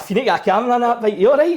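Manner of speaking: fast, high, loud rush of concern; excited concern. Retold or imitated. Telling the cyclist to get a bike camera, fast and worried. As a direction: rattle it off, then check he's okay. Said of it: "I was like".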